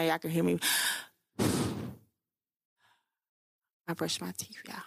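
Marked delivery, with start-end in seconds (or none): 1.4-1.9 heavy audible sigh; audible breathing. Real, not acted. Vlogger lets viewers hear their breathing, audible sigh. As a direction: let out a long breath. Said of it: "I feel myself breathing right now".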